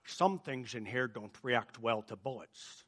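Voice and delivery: low, soft, slow Scottish accent impression; movie-quote Scottish accent, drawn out; Sean Connery. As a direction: put on a hushed, deep Scottish accent. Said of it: "do my best accent on this one".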